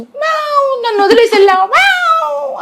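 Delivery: very high mock-angry meowing; squeaky-high sulking cat voice. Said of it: "అని కోపంగా".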